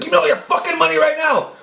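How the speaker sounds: high-pitched imitation of a knife-wielding robber; mock robber demand. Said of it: "He's like".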